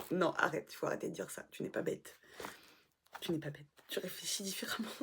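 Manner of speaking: soft, low, half-whispered self-talk; gentle self-correction. Real, not acted. Vlogger telling herself she isn't stupid, quiet reassurance. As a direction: murmur it to yourself.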